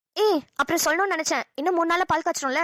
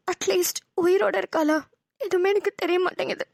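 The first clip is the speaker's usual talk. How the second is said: higher, aching voice of worry; sad, missing sister; 4 semitones higher than usual. Acted. Mother grieving her lost sister to her daughter, sad and higher. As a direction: let the worry for her sister crack through. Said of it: "உனக்கு இந்த serious face".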